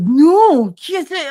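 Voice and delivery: high-pitched horrified objection, loud at first; retold alarmed protest. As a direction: act out stopping a colleague. Horrified colleague stopping the teacher, retold.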